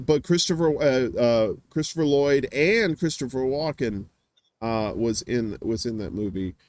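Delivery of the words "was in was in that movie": normal talking voice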